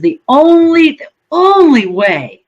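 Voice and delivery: sudden high, loud demand, drawn out; retold loud plea. Bargaining with God on the porch, a loud slow demand. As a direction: cry it out at the sky, slowly. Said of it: "And I said".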